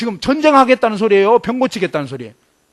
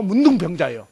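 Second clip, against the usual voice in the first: ordinary talk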